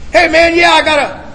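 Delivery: high, loud opener on a call; eager phone greeting. Retold or imitated. Caller asking guys on his list for help, eager. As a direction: launch hopefully into your plea.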